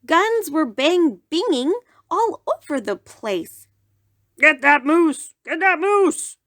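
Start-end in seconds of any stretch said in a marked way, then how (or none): 4.4-6.4 loud, high hunting cry; hunters shouting. Acted. Hunters shout as they chase the moose, loud and high. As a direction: bark the order at the chase.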